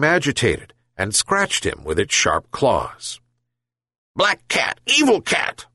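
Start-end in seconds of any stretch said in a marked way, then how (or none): 4.2-5.7 high, angry dog voice; angry yelp. Acted. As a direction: yap it at the cat. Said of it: "yelped Boli".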